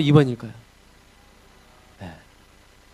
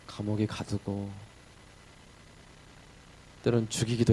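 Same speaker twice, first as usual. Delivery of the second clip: humble prayer; 3 dB quieter than usual. Acted. Paul thanking Jesus from prison, soft humble prayer. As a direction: pour out a quiet, awed thanks.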